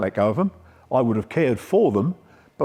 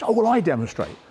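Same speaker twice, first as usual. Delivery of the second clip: higher-pitched imitation of a keen coach; Mark Cox; 6 semitones higher than usual. Posh coach answering who demonstrates, imitated in higher pitch. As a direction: raise the pitch to voice another coach.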